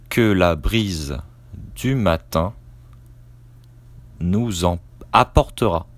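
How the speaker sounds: dictating. Shown as a demonstration. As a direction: dictate it chunk by chunk, naming the punctuation. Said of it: "Je commence la dictée".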